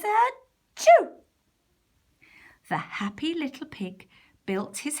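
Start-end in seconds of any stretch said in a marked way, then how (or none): 0.0-1.2 big theatrical achoo; loud sneeze. Acted. Man with straw sneezes loudly.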